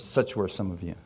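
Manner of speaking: low and hushed, like a voice in his ear; soft inner voice. Retold or imitated. God whispering scripture into the preacher's ear, hushed. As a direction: let it drift in quietly from beyond.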